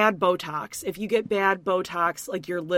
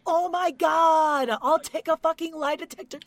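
exasperated Amber; 8 semitones higher than usual. Retold or imitated. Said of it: "she said".

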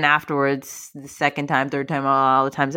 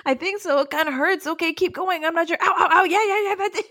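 squeaky, high, hammed-up nervous voice; high mock voice; 12 semitones higher than usual. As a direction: act it out in a squeaky voice, half laughing. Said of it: "And he's like".

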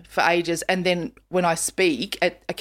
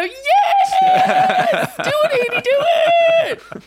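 delighted high squeal; gushing auntie; 6 dB louder, much slower than usual. Retold or imitated. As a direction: squeal loud encouragement, then let it drop. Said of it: "And I go".

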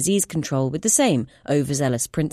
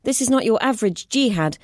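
higher, earnest explaining voice; urgent scientist; 4 semitones higher than usual. Acted. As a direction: lay out the evidence urgently. Clara explains findings.

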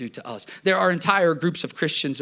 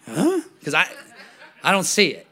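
one raised eyebrow at the congregant's remark; puzzled huh; 3 semitones higher than usual. Retold or imitated. Pastor answers a churchgoer with a quizzical huh. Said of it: "I'll, like, raise one eyebrow".

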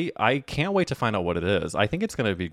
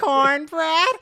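amused; 6 dB louder than usual. Real, not acted. High amused echo.

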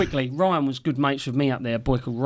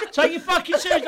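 high imitated command; high mock order to take your shoes off; 12 semitones higher than usual. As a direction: bark it in a squeaky mock voice.

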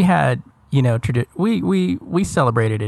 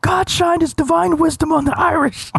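mock grandiose. Co-host mocks the speaker.

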